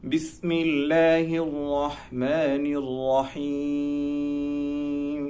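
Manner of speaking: very slow melodic tilawah; slow chanted Quran recitation. Acted. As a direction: recite the surah slowly, each phrase drawn out. Recites the whole surah.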